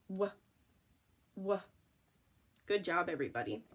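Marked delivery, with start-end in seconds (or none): 0.1-1.6 "w" as a short wuh, not double-u; isolated wuh for each "w"; letter sound. Shown as a demonstration. Teacher showing W before H, short letter sound. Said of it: "if there's a W in front".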